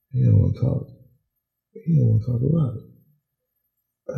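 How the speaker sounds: usual speaking voice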